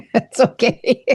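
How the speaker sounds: giggling through the words; laughing. Real, not acted. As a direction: laugh it off gently. Laughs after interrupting friend.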